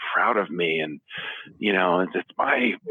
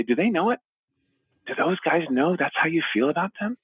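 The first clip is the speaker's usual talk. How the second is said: higher, lifted query; father nudging sons, raised pitch; earnest probing question; 12 semitones higher than usual. Retold or imitated. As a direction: press them gently to think. Dad asking pointed question.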